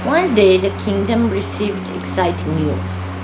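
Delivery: ordinary delivery, nothing marked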